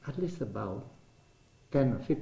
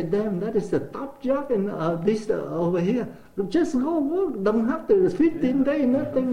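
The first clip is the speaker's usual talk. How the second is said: much higher and louder, thrilled coworkers; excited exclaiming; 10 semitones higher, 6 dB louder than usual. Retold or imitated. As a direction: cheer him on to go right now. Coworkers react to his top job.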